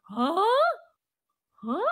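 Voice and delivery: imitated rising "Ha?"; baffled huh. Imitating how her friend kept reacting, baffled. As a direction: mimic a startled, questioning huh. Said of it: "Ganun talaga siya, parang".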